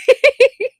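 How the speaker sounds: high, loud laugh. Real, not acted. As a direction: crack up at the line. Laughs after the song quote.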